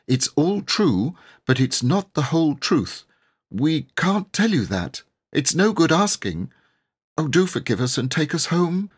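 put-on a small girl close to tears, pleading; young girl's high, tearful plea; pleading little girl. A narrator's reading. Jane begging forgiveness.

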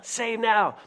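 urgent cry. Retold or imitated. Crowd's plea repeated.

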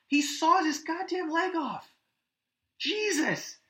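horrified high voice. Real, not acted. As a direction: squeal it out in disgust. Horrified retelling of leg scene.

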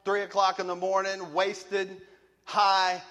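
high, loud, driving testimony; preaching fervor. Real, not acted. Preacher recalling coming home wasted, loud and high. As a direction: keep the fire going.

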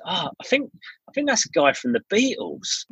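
put-on girlfriend. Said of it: "my girlfriend looks at me and she goes".

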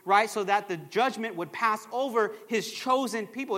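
ordinary talk

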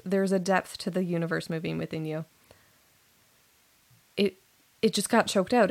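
ordinary talk

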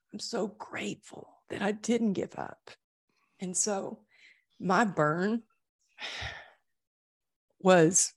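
fighting back real tears as you speak; getting emotional. Real, not acted. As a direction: voice catching with genuine emotion. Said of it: "Sorry, I'm getting emotional".

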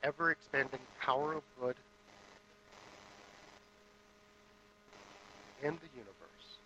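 hushed contemplative guided reflection; guided meditation. Acted. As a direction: lead the room inward, hushed and unhurried. Guided meditation opening service.

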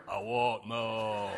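put-on low, slow little-girl demand; deep-voiced toddler. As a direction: drag it out deep like a toddler wanting milk. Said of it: "you'd hear".

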